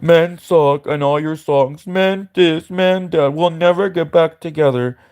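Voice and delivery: slow, sneering put-on parody of a complaining singer; mock her lyrics in a whiny drawn-out voice. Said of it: "when you were saying".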